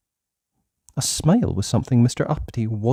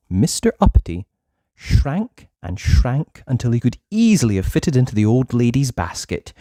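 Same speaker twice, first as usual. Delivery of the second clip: ordinary delivery, nothing marked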